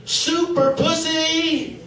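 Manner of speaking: mock superhero cry. Wife jumps out in costume.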